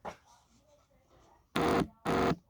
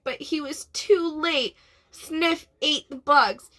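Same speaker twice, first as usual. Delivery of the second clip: usual speaking voice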